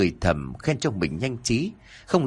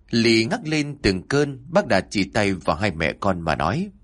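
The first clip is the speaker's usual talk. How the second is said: usual speaking voice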